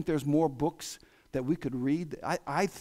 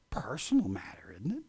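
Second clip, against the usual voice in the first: hushed, sneering whisper of a critic; mocking whisper; 4 semitones lower, 5 dB quieter than usual. Preacher mocks critics in a hushed whisper. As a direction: whisper it like a smug secret. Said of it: "I love these people who say".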